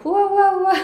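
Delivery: high, loud breathy puffs of "ふわふわふわ"; playful sound demo of "ふわふわふわ"; airy puffing demo. Shown as a demonstration. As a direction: puff "ふわふわふわ" out high and loud like air escaping. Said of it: "この緊張した舌のところでなんかこう".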